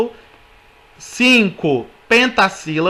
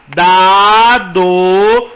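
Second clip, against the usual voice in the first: "dado" drawn out into two long, loud beats; separação por sílabas; 3 semitones lower, 4 dB louder than usual. Shown as a demonstration. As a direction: stretch "dado" slowly, holding each syllable. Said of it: "Contamos pelo som". ("dado" escandido, sílaba por sílaba, bem alongado)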